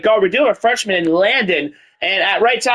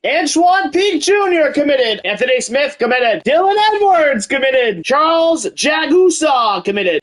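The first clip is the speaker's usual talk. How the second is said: hype commit announcer; 6 semitones higher than usual. Acted. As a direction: call out every signing like a stadium announcer.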